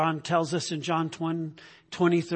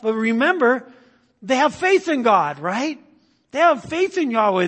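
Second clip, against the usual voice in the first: loud, high preacher's drive; loud, pitched-up preaching; fervent preaching; 4 semitones higher, 7 dB louder than usual. Acted. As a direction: press the point to the congregation. Preaching at full force.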